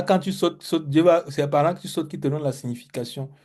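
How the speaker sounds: usual speaking voice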